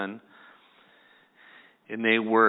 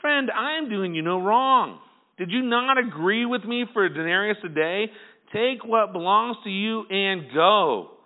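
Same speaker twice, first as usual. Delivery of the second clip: stern master; 8 semitones higher, 5 dB louder than usual. Retold or imitated. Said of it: "He replied".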